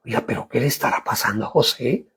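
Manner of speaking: quick, hushed wondering aside; soft, quick puzzled question. Retold or imitated. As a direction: mutter it fast like a curious courtier. Pharaoh's household wondering aloud.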